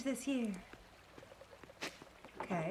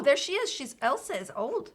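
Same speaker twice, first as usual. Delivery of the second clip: normal talking voice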